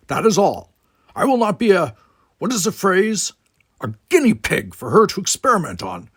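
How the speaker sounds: a proud Klingon brushing the talk aside; Worf's voice. A narrator's reading. Worf refuses, walks out.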